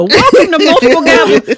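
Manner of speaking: high host impression; imitating host voice. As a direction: mimic her host opener. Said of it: "You have never gotten through the".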